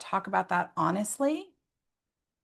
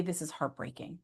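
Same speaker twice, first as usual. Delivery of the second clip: much softer, hushed and sad; softly heartbroken; 2 semitones lower, 11 dB quieter than usual. Shown as a demonstration. Parent sharing grief with a child, hushed. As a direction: let it drop to almost nothing. Modelling telling kids your feelings.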